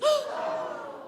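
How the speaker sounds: high gasp with a laugh; big surprised gasp. Shown as a demonstration. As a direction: gasp like a lion face. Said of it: "Lion face".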